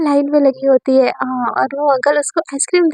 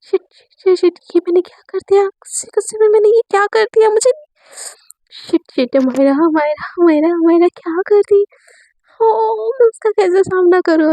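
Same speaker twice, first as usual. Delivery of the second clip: higher, frantic muttering with a near cry; horrified at what she just did; panicked; 5 semitones higher than usual. Acted. Amaira panics alone after the accidental kiss, frantic. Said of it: "बेचैनी एकदम बढ़ जाती है".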